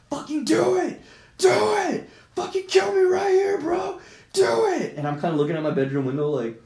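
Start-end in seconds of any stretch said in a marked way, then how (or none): 0.1-5.0 very high, strained dare at full shout; crazed retold shouting. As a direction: imitate someone screaming a reckless challenge. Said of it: "just going crazy".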